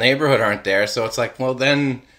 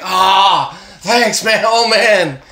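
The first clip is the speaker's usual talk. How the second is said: relieved plant; 7 semitones higher, 4 dB louder, slower than usual. Pretend. Voicing watered plant.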